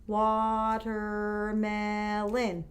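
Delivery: "Watermelon" drawn out slowly, four separate beats; syllables tapped out. Shown as a demonstration. Teacher tapping syllables for the class, very slow. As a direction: tap out "Watermelon" one syllable per finger. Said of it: "Let's tap it again".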